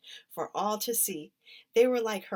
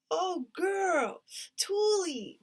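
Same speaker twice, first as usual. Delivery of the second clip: much higher, louder alarmed cry; 10 semitones higher, 3 dB louder, much slower than usual. A narrator's reading. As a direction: cry out seeing her friend fall. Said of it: "Dreeny cried".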